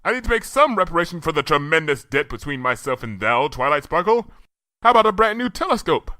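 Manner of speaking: high, formal and earnest; a princess insisting on repaying a debt; Luna's archaic royal voice. A narrator's reading. Luna to Twilight, grandly offering a gift.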